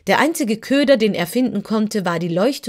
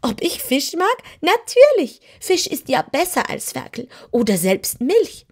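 much higher, louder gleeful kitten voice; delighted kitten; 8 semitones higher, 4 dB louder than usual. Acted. Kitten answers the wizard, thrilled about fish. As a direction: gush with greedy delight.

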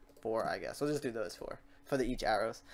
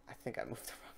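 worried whisper; 3 semitones lower, 13 dB quieter, faster than usual. Real, not acted. Worried about a wrong move.

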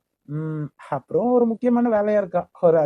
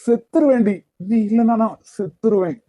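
loud, raised pleading outburst; anguished yelling at his love; desperate angry shout; 3 semitones higher, 4 dB louder than usual. Acted. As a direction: throw it at her in despair, loud and high. Heated lovers' quarrel.

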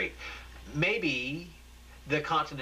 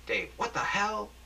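high, slow alarmed question; stunned newsroom alarm; shocked; 10 semitones higher than usual. Acted. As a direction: cry out in disbelief. Newsroom shock, character voiced.

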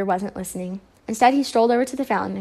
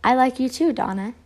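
usual speaking voice

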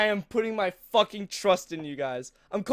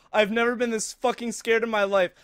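normal talking voice

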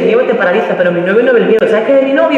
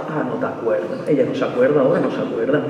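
put-on lower, softer sulky boyfriend; boyfriend sulking; 8 semitones lower, 5 dB quieter than usual. Comic imitating boyfriend complaining she forgets, lower and sulky. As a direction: sulk like the offended boyfriend.

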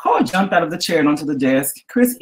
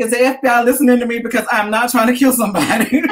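mock panic; 9 semitones higher, 4 dB louder than usual. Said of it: "I'm like".

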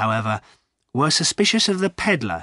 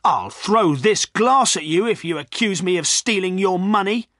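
higher-pitched, heated threat; angry threat; 7 semitones higher, 4 dB louder than usual. A narrator's reading. Poacher threatening his accuser, angry. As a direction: threaten him indignantly. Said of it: "cried Jem angrily".

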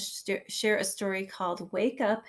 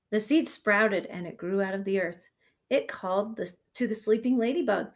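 put-on reading to children; 3 dB louder than usual. Reads picture book to kindergarten.

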